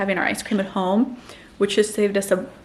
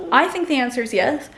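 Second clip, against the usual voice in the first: usual speaking voice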